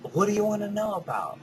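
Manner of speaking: fast, eager question from a friend. Retold or imitated. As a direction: voice the curious friend. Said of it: "voicing a friend asking".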